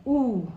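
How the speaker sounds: U said as a rounded oo; teacher showing the Chichewa vowel; vowel sound U. Shown as a demonstration. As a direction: model U clearly for learners. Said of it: "Like O in boat".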